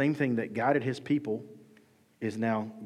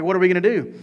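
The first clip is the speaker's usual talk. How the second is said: raised, louder imitation of panic; mock freaking out; 5 semitones higher, 7 dB louder, faster than usual. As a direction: act out a panicked crowd, pitched up. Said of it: "the people are freaking out".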